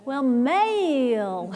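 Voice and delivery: very slow, loud Southern drawl on the name; teasing Southern accent, drawn way out; imitated Southern drawl. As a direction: stretch Mel into several syllables, mock-Southern. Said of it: "ever had four syllables".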